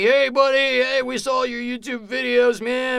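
put-on high, loud, gushing drunk welcome; drunk buddies. Drunk guys hugging their new roommate, loud and gushing. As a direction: greet him like an over-friendly drunk fan. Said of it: "drunk off their ass".

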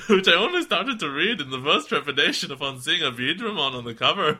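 giggling Irish-ish reading; mock Irish accent. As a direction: read it in a hammy Irish lilt. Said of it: "It's like my Irish accent".

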